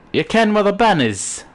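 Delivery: high, swooping East Coast Scots; mock an aggravating East Coast Scottish voice; East Coast Scottish accent. Streamer imitating the accent everybody hates, hammed-up East Coast Scottish. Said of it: "the Scottish accent everybody hates".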